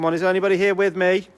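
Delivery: high, loud calling out; talking to a ghost. Real, not acted. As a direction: call out to the empty building, fast. Calling out to a spirit.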